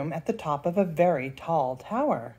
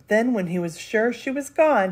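plain reading voice

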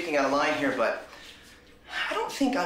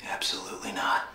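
whispered reply. Acted. Eager henchman answers.

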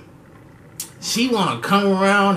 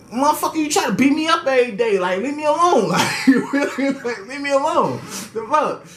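high, fast fed-up rant with a laugh; exasperated; 8 semitones higher than usual. Retold or imitated. To the girl who hits him, high fed-up rant. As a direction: tell her to quit it already.

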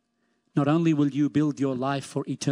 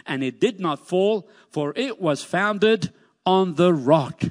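higher, fervent reading with slowed ends; scripture preaching; 4 semitones higher than usual. Acted. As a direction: proclaim the parable to the congregation. Preaching the parable.

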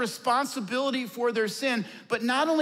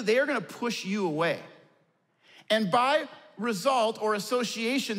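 ordinary delivery

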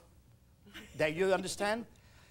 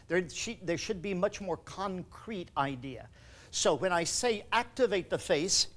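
ordinary talk, nothing marked

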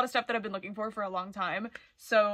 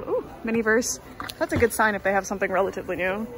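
normal talking voice

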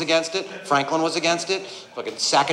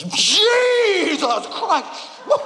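stunned shout; 13 semitones higher, much slower than usual. Acted. Appraiser shouting as he reads Jesus's name on the piece. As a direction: let the shock explode out of you slowly.

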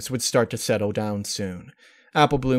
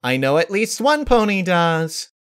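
a hint of a higher playful tease; mildly raised sing-song tease; lightly teasing; 8 semitones higher, 5 dB louder than usual. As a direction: nudge a friend playfully. Pinkie teasing Rarity.

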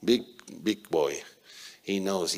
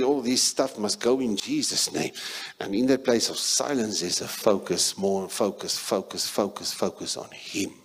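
ordinary talk

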